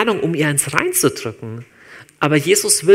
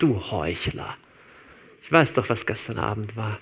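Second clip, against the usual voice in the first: lower, softer menacing accuser; voice of the enemy; 5 semitones lower, 5 dB quieter than usual. Retold or imitated. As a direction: hiss the accusation low and close. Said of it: "die Stimme des Feindes". (leise, tiefe Stimme des Feindes)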